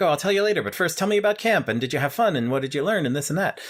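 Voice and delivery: high, bright-eyed dodge of a dad; cheery dad. Retold or imitated. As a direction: deflect with chirpy enthusiasm. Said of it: "the dad's like".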